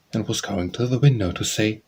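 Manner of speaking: ordinary delivery, nothing marked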